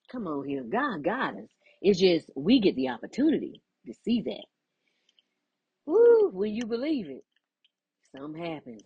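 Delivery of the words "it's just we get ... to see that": ordinary delivery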